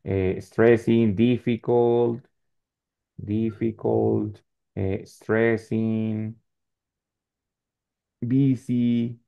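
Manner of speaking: each word stretched slowly for the class; slow teacher listing. Shown as a demonstration. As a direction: drag out stressing, difficult, difficult, stressing. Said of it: "slow model vocabulary list".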